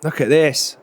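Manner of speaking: ordinary talk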